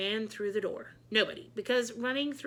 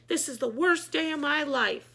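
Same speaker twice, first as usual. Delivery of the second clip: higher, louder lament; distraught outburst; 6 semitones higher, 5 dB louder than usual. A narrator's reading. Author lamenting as she leaves, higher and louder. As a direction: wail it out in despair.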